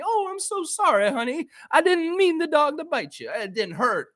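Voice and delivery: put-on high, sweet old-lady voice. Old lady reassuring the delivery man, high sweet voice. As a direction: coo it like a kindly old lady at the door. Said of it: "this nice old lady came".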